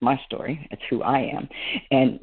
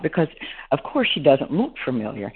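usual speaking voice

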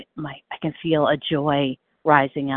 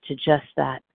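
ordinary delivery

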